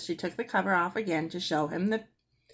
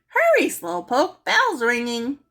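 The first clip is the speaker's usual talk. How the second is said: high, loud, slow taunting yell; a parrot teasing Mary out the door; parrot yelling after her; 6 semitones higher, 7 dB louder, slower than usual. A narrator's reading. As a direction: yell it after a child running off. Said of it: "Penelope yelled after her".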